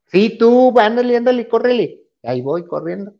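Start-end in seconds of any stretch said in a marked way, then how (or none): 0.1-2.0 high, insistent hurry-up; urgent hurrying. Retold or imitated. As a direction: imitate a crew member rushing someone along. Crew hurries him to get miked. (apurándolo con voz aguda y urgente)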